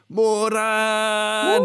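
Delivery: high, loud call of a name; Margaret's mom yelling. Retold or imitated. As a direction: shout the name like a Korean mom calling. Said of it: "doing an impression of your mom".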